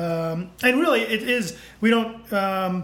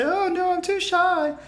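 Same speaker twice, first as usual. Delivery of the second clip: put-on shy girl voice; 10 semitones higher than usual. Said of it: "and she'll say".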